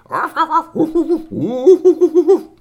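frantic loud high arfs and woofs; high-pitched barking run; dog barking. Acted. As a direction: yap excitedly like a dog. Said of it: "Maxie went".

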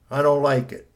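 put-on a touch of grumpy kid digging in; grumpy boy. A narrator's reading. Son complains to mother about homework, grumpy. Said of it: "He was looking so grumpy".

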